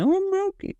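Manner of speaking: very high mock toddler whine; whining little daughter. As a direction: squeal it like a small child pleading. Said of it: "She's like".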